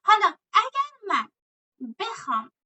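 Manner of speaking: ordinary talk, nothing marked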